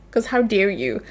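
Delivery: ordinary talk, nothing marked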